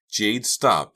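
high, loud command; cried out. A narrator's reading. Girl stopping friend near danger, crying out. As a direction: call out to halt her. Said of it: "Dina cried".

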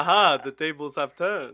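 loud, hammed-up vampire villain; Dracula. Vampires turning stakes on hunters, hammy Dracula voice. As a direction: play a gloating vampire with relish. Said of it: "uh, Dracula, like".

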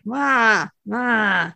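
mummy noises. Retold or imitated. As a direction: moan it like a mummy. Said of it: "he makes mummy noises like".

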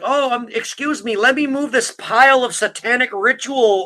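lightly voiced prissy woman; mock polite lady. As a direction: play the fake innocent hostess. Mocking the detective lady.

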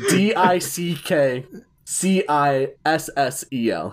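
slow, letter by letter; spelling out letters. Shown as a demonstration. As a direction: spell each letter out slowly. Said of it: "I will spell this for you".

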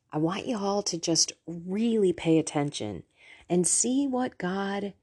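ordinary delivery

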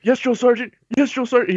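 high, timid yes-sir voice; meek recruit reply. Retold or imitated. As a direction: squeak out a nervous answer. Recruit answering his drill sergeant.

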